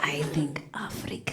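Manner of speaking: softly whispered guess through a laugh; sly whisper. Retold or imitated. Balinese man guessing her origin, in a sly laughing whisper. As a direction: lean in and whisper it knowingly. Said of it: "And he goes".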